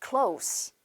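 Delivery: "close" ending in a crisp unvoiced s; s sound. Shown as a demonstration. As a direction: model "close" as the adjective, s not z. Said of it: "close가 아니라 따라하세요".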